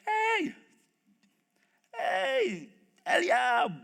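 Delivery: high, held-out hey to a brother; calling out. Acted. Acting David calling his brother.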